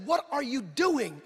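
slow, drawn-out mock challenge; slow retold scolding question; disbelieving challenge. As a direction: stretch each word, imitating a disapproving wife. Wife's first challenge, retold.

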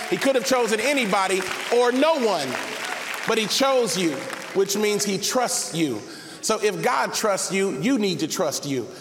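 impassioned, rising sermon climax, high and punchy; full-force preaching. Real, not acted. Preacher urging the congregation to trust their calling, full-force preaching.

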